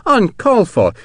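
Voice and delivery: indignant objection, pitched very high; protesting. A narrator's reading. Colonel refusing an over-generous gift, protesting. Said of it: "McCandless protested".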